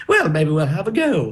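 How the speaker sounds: imitating a friend's put-on English accent; mock English accent. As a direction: affect an English accent, mockingly. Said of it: "come back with an English accent".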